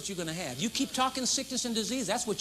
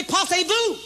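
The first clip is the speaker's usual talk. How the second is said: laughing dismissal; 10 semitones higher, 7 dB louder than usual. Acted.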